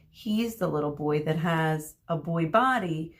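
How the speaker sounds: usual speaking voice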